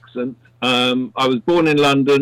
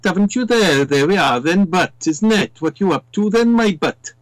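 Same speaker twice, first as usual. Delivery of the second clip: higher, lilting Welsh impression; Welsh accent; 4 semitones higher than usual. Shown as a demonstration. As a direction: put on a singsong Welsh accent. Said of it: "you've got the Welsh accent".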